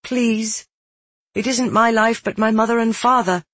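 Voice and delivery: high, urgent, imploring; pleading. Acted. Pleads for parents' lives.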